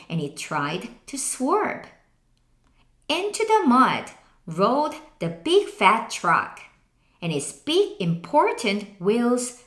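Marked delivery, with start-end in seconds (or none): none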